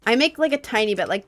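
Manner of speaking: ordinary talk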